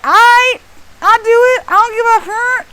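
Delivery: high, loud, devil-may-care reply; acted cheeky consent; brazen agreement. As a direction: toss it out high and reckless.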